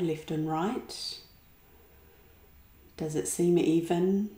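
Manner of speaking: unhurried meditation voice with long pauses; calm relaxation-practice guidance, phrase by phrase; guided meditation. Acted. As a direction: guide them gently inward, leaving space after each phrase. Guides a body-awareness meditation.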